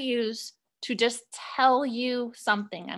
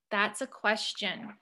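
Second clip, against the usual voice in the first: ordinary talk, nothing marked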